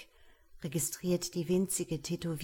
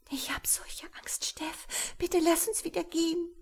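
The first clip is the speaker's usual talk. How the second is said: much higher, breathy, pleading whisper; frightened plea; 11 semitones higher than usual. Acted. Wife clings to husband, begging high and scared. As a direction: beg him to leave, voice rising in fear.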